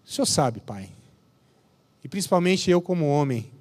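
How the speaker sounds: slow, humble prayer with long pauses; lightly voiced pastor's humble prayer. Leads a closing prayer.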